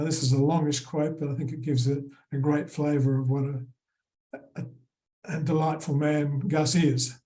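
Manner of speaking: ordinary talk, nothing marked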